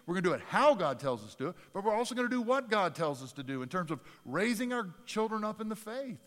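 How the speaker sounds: rally the parents to stand firm; preaching at full force. Acted. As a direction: passionate preaching. Exhorting parents.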